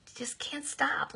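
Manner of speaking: a breathy whispered confession; whispered self-admission; whispered aside. Real, not acted. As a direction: drop to a whisper, as if letting them in on a secret. Confiding aside about talking a lot.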